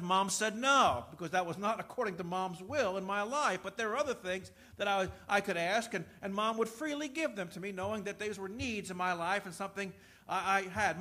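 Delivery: normal talking voice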